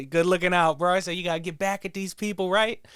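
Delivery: thanking the cashier, high and loud; hyped thanks. Retold or imitated. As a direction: hype him up like a buddy.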